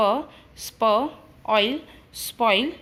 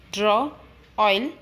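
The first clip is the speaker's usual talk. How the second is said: ordinary talk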